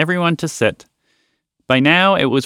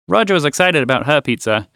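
plain reading voice

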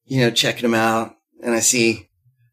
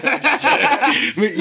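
high laugh; 13 semitones higher than usual. Real, not acted. Laughing at own joke.

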